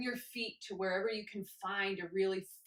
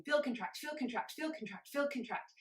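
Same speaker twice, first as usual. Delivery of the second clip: faster; 4 semitones higher than usual. Shown as a demonstration. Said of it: "Maybe you wanna go faster".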